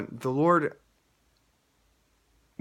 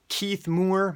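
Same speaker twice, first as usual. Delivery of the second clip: raised, announcer-like name call; 7 semitones higher than usual. Retold or imitated. Announcer draws a contest winner's name, calling it out.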